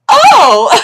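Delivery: loud, high, long "oh!"; mock shocked cry. Lady reacts to next number called, loud mock shock. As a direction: burst out in exaggerated surprise.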